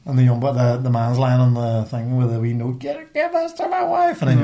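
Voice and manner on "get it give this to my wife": high strained dying soldier plea. Retold or imitated. Reenacting the film, a dying soldier's high plea.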